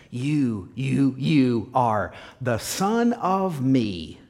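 slow, separate beats on each "You"; emphatic YOU. Acted. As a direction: set each "you" apart, then spell out the phrase slowly. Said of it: "Luke is really emphasizing this".

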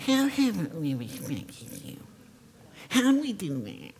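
put-on high, soft childish whine; whiny kids. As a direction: sulk like a caught child. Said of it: "It's like kids".

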